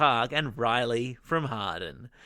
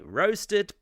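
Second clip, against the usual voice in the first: ordinary talk, nothing marked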